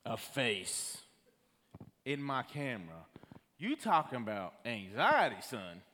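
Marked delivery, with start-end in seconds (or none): none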